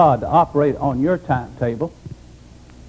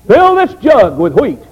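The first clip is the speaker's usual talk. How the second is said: put-on old woman's high pleading prayer; praying woman; 10 semitones higher, 7 dB louder, much slower than usual. Old woman praying to the Lord, pleading loudly. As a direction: cry out to the Lord for wheat, higher and louder.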